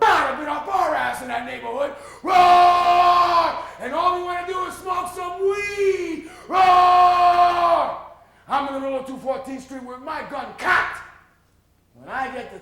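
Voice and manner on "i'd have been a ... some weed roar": high, loud rowdy roar; roaring shout. Acted. Rowdy neighborhood crowd roaring chants, loud. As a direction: bellow like a crowd.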